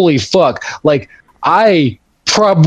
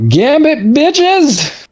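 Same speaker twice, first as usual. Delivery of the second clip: hype announcer; 9 semitones higher, much slower than usual. Acted. Introducing himself in style.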